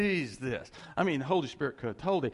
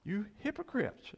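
high, pointed rebuke; name them plainly; Jesus rebuking; 11 semitones higher than usual. Retold or imitated. Jesus to the hypocrites, high pointed rebuke. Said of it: "He said".

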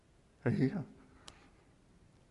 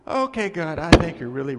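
louder burst on "Okay, God" and "think"; startled realization; 9 dB louder, faster than usual. Retold or imitated. James realizing God means it, loud startled burst. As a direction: blurt it out as the truth sinks in. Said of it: "he's like".

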